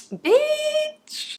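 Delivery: sudden very high triumphant squeal; high-pitched proud squeal; squealed bitch. Real, not acted. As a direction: shriek it in delight. Celebrating one month sober.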